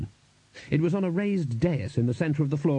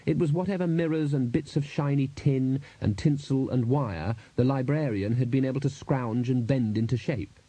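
plain reading voice

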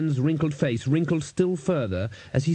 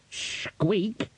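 squeaky rat voice; 8 semitones higher, 3 dB quieter than usual. Acted.

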